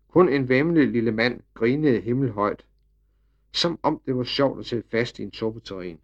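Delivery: ordinary delivery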